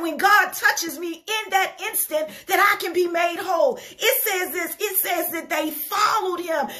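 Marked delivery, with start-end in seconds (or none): none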